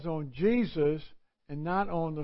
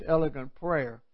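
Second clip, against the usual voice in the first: ordinary delivery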